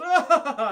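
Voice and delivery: loud, high laughing cry; villain laugh. Retold or imitated. Mysterio flying into the sky, loud laughing cry. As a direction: cackle while flying off.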